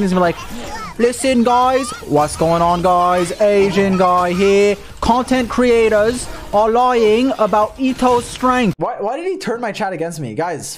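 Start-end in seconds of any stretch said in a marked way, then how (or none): 1.0-8.7 loud, slow British streamer impression; mock British contrarian announcing to his viewers; Atsu. Atsu contradicts other creators to his viewers, loud British impression. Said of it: "Atsu's gonna be contrarian".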